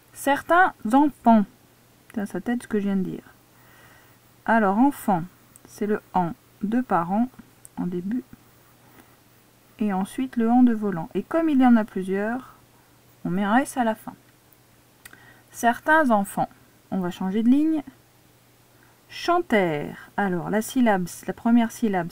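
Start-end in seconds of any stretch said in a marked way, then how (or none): 0.1-1.5 slow, deliberate dictation read; slow dictation voice for a pupil. Shown as a demonstration. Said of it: "teacher dictating the sentence". 19.1-20.1 very slow, drawn-out dictation word; slow dictated word. Shown as a demonstration. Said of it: "teacher dictating the next word".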